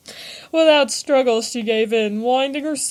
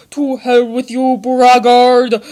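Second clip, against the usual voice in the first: much louder furious roar on braggart; angry bellow; 8 dB louder, slower than usual. A narrator's reading. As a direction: bellow it at the drunk. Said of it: "bellowed the angered Accordion".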